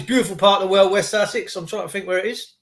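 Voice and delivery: ordinary talk, nothing marked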